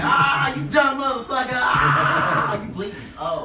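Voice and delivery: loud, high mocking laughter-shout; mocking a friend who fell; laughing shout. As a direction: cackle insults at him.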